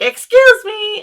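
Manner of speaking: a lightly voiced older lady pushing past; drawn-out mock polite excuse me; older woman's excuse me. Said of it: "the older woman's like".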